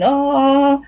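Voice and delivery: pupil's limp oh; feeble oh. Retold or imitated. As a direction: push out a timid oh. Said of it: "And I'm going".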